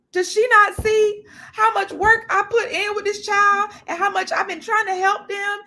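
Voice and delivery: hurt teacher pleading, half-mocked; imitating a crying teacher. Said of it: "the teacher's in there crying".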